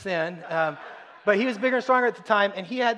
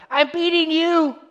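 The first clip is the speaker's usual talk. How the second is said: put-on loud kid's cry; 11 semitones higher, 7 dB louder, slower than usual. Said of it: "I just hear this really loud".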